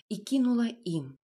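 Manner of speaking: ordinary delivery, nothing marked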